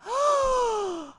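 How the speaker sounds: a sharp, loud gasp of delight. Real, not acted. Streamer celebrating the right pronunciation, loud delighted gasp. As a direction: gasp as if she won. Triumph after getting word.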